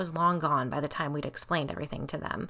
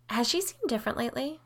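high, quick probing question; pressing; 7 semitones higher, faster than usual. A narrator's reading. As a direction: press gently but quickly for an answer. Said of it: "Biddy pressed".

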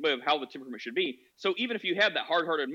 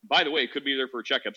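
ordinary delivery

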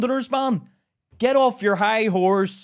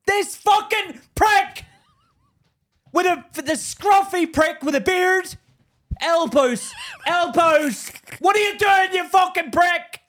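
slow, higher furious yelling at a stranger; retold angry yell; 8 semitones higher, slower than usual. Man yelling at an elbowing commuter on a train, angry. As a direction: bark it out like he's shoving past you.

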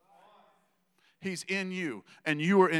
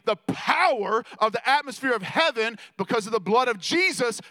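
higher and louder, building preacher's cry; full-force preaching; 6 semitones higher, 7 dB louder, slower than usual. Acted. Preaching climax of sermon.

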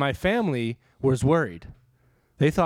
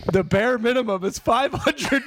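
laughing punchline. Retold or imitated. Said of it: "He was like".

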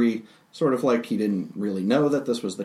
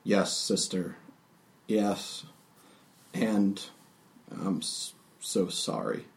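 softer, sighing grief fading to a breathy break; sorry words that die away into a sigh; sighing sadly; 4 dB quieter than usual. Acted. Sator sadly apologizing to his sister, soft and sighing. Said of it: "kind of sighs sadly".

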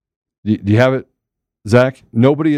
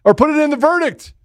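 hammed-up evidence voice; 11 semitones higher than usual. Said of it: "and says".

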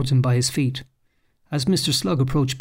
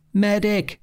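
mock army cry; 7 semitones higher than usual. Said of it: "the Colonel cried".

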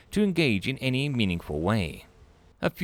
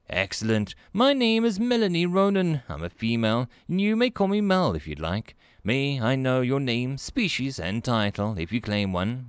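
a cheerful human clerk, pitched up; friendly receptionist Melanie; 3 semitones higher than usual. Acted. Melanie voiced high.

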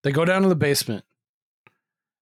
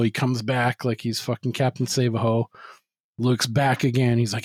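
ordinary talk

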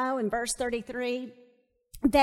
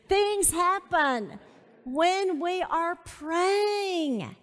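very slow, raised and emphatic; slow emphatic repeat; 5 semitones higher, 3 dB louder, much slower than usual. Real, not acted. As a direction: draw out THINGS HAPPEN WHEN WE ARE PRAYING.

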